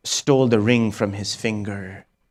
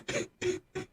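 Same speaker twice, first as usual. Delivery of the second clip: high broken sob; 11 dB quieter than usual. Real, not acted. Sob sound after wail.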